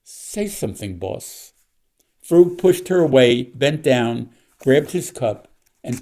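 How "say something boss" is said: whisper. A narrator's reading.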